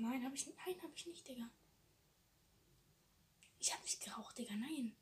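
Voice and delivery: hushed denial; whisper. Real, not acted. Denying to a friend, in a whisper, having smoked. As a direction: deny it under your breath.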